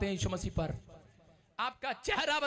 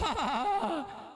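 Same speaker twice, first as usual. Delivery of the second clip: laughing; 7 semitones higher than usual. Retold or imitated. Preacher reenacting being made to laugh. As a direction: laugh out helplessly. Acting out his own laughter.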